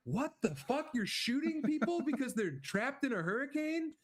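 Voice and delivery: mock horrified disbelief; shocked outrage. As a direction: act appalled and disbelieving. Retelling own shocked reaction.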